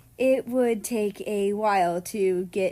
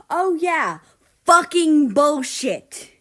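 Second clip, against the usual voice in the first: loud, slow, furious curse; angry outburst; 6 semitones higher, 10 dB louder, slower than usual. A narrator's reading. Mexico cursing at America about tacos. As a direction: spit the words out in disgust.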